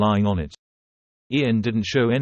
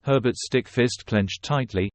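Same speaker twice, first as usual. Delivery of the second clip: ordinary delivery, nothing marked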